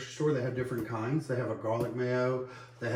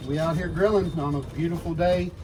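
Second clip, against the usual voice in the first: vlog host intro; 5 semitones higher, 6 dB louder than usual. Acted. Host addresses viewers at grill.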